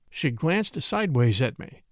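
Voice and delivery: plain reading voice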